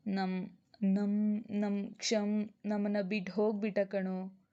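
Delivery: mildly tearful, stammering on the first words. A narrator's reading. Telling his friend that Ksham is gone, mildly tearful. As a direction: break the news haltingly, a touch of tears. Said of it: "ಯಾಕೋ ಅಳ್ತಿದ್ಯಾ?".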